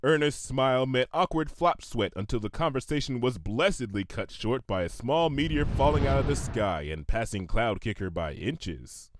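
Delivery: plain reading voice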